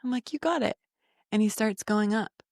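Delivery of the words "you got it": higher-pitched quick cheer to a little climber; retold pep talk, pitched up; encouraging. Mom cheering her son onto the rock wall, encouraging.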